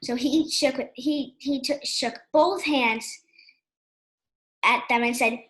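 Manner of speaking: ordinary delivery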